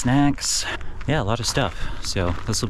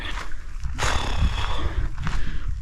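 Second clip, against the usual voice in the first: heavy sigh. Real, not acted. Tired hiker catching breath uphill.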